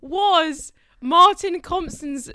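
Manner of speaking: loud, high, amused objection; laughing indignant protest. Real, not acted. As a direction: protest loudly at a bad imitation. Mock protest at being imitated.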